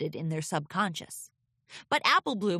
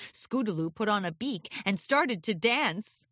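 usual speaking voice

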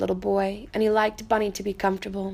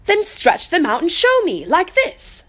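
a high, loud wild rabbit demanding proof; challenging wild rabbit; 7 semitones higher, 5 dB louder than usual. Acted. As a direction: show off while you challenge him. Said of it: "said the wild rabbit".

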